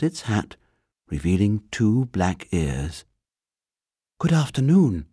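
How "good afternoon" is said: high, polite little bear; a small bear greeting politely, higher; small clear voice. A narrator's reading. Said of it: "it said in a small, clear voice".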